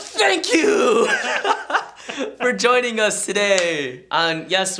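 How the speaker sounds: thanks said through laughter, high and bright; giggling as the host thanks viewers; laughing outro. Real, not acted. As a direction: laugh your way into the sign-off.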